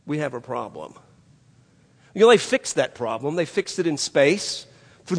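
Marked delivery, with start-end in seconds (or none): 0.0-1.0 much softer, cool report; calm. Retold or imitated. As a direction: state the problem without any alarm. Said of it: "Just so calm".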